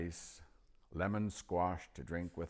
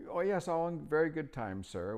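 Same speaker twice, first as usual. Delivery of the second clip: put-on higher, louder old wizard voice; old magician; 11 semitones higher, 6 dB louder than usual. A narrator's reading. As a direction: pitch up into the chatty old magician. Narrator voicing old magician.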